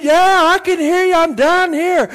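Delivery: high, loud shout back up the cliff; loud, high-pitched answering yell; calling out. Acted. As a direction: holler eagerly to someone far above. Stranded man calls back up.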